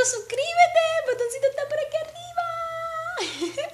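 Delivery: a sweet high-pitched plea; cutesy call-out. Acted. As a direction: coax them to subscribe like a playful child. Cute subscribe call-out.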